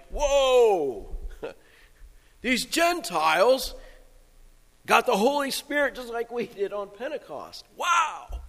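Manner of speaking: high, drawn-out amazed whoa-and-wow; astonished. Acted. Preacher acting out Peter amazed at the Gentiles, astonished. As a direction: marvel in a high, stunned voice.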